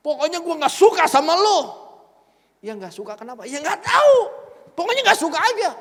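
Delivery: loud, high curt retort; imitated loud huffy boss; stubborn anger. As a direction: shut him down without reasons. Pastor snapping at young Leo.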